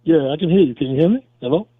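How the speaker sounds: caller on a muffled phone line; muffled, distant speakerphone. Real, not acted. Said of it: "you sound muffled".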